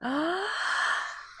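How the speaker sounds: long audible breath in; teacher showing deep breathing. Shown as a demonstration. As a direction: model a big inhale. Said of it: "take a deep breath with me".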